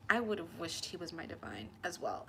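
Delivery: normal talking voice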